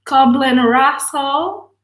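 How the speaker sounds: slow, stretched "Coblin Russell" with rising end; deliberately mispronounced name; mangled name. Real, not acted. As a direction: drawl out "Coblin" as if unsure. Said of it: "whenever you see this, you're gonna laugh".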